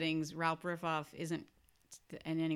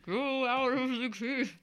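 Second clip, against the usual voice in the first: higher, slow call through a megaphone; slow, high calling voice from the cartoon bat; 7 semitones higher, slower than usual.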